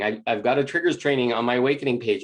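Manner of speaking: usual speaking voice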